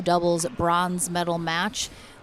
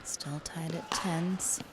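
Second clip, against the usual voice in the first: slow hushed whisper; 10 dB quieter, slower than usual. Real, not acted. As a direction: whisper so the players can't hear. Hushed commentary before serve.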